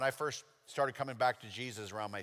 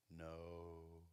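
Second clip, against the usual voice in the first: ordinary talk, nothing marked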